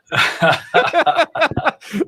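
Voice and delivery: high burst of laughter; big laugh. Real, not acted. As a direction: laugh hard at the memory. Laughs at his own story.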